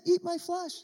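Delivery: high talking-doll voice, eat stressed; chirpy doll saying something creepy; pull string toy. Pretend. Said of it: "you pull it again".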